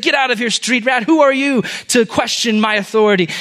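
high, forceful scolding; angry rebuke. Acted. Executioner hits the prince and challenges him, angry rebuke.

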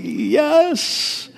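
high, drawn-out "yes" of delight; retold elation. Dad recalls hearing it's a boy, excited cheer. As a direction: relive a stoked cheer without shouting. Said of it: "I was excited to have a baby boy".

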